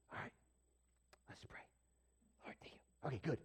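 hushed, furtive near-whisper; embarrassed. Acted. Diner praying over a burger in public, embarrassed. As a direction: rush a tiny prayer under your breath. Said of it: "You're, like, a little embarrassed".

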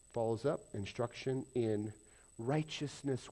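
ordinary talk

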